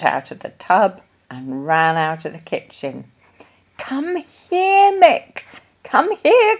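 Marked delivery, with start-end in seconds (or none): 3.8-6.6 high, loud call after the runaway puppy. A narrator's reading. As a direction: call the dog back, much higher and louder. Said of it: "called Missus Brown".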